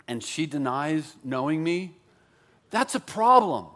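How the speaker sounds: ordinary talk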